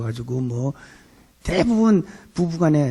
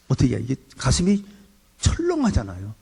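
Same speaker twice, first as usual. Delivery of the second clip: normal talking voice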